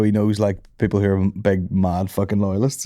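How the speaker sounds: ordinary delivery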